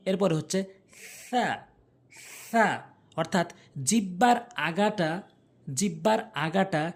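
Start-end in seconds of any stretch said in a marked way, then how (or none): 1.2-2.7 সা as a light th, tongue tip at the front teeth; soft th sound. Shown as a demonstration. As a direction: say সা lightly, like English "th". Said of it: "হালকা করে".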